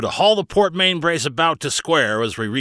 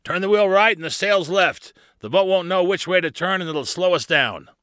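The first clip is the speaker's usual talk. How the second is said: raised-pitch urgent instructions; called-out order; 8 semitones higher, 3 dB louder than usual. Acted. Barnabas instructs Carly in the riptide, calling urgently. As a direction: keep the commands coming briskly.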